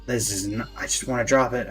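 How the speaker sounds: ordinary talk, nothing marked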